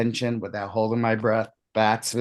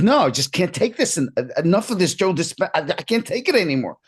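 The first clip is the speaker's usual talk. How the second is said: higher, exasperated imitation; fed-up outburst; 7 semitones higher than usual. Diner storms out on Joe Dispenza, fed up. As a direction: act out someone at the end of his patience. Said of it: "He gets up and storms out".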